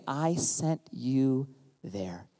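slow, low, soft emphasis. Acted. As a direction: let each word land slowly. Repeat of God's words.

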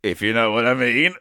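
low, insinuating catchphrase echo; copying co-host. Retold or imitated. Echoing the co-host's stuff back at him, low mimicry. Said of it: "he's copying my stuff now".

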